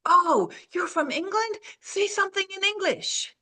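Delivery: high-pitched, wide-eyed imitation; mock fascinated stranger. Stranger asking an English newcomer to talk, mock fascinated. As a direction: put on a high, marvelling voice. Quoting a gawping stranger's request.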